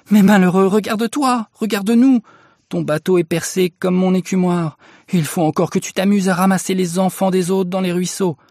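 loud, high-pitched scolding; exasperated wife's railing; furious tirade. A narrator's reading. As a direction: berate your hapless husband at full pitch. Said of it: "la mère Louveau éclata".

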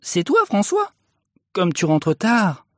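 very high-pitched woman's voice; high voice, drawn-out reproach; wife's offhand greeting. A narrator's reading. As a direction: call to your husband without turning round. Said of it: "dit sans se déranger".

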